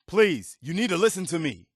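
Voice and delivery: desperate appeal to an ignoring friend; urgent plea. Acted. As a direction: beg them to listen, voice lifted. Companion urgently begging player.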